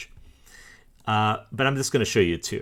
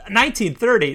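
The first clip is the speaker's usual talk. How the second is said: high, loud burst of dismay; exasperated surprise; 12 semitones higher, 6 dB louder than usual. Real, not acted. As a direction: throw it out like "not again!". Finds yet another record starting 1930.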